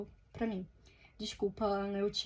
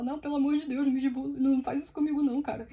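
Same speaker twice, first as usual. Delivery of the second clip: choro imitado, suplicante; 3 semitones higher, 5 dB louder, faster than usual. Retold or imitated. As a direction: act out begging through tears, hammed-up. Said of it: "Aí eu comecei a chorar". (súplica chorosa, imitando o próprio desespero)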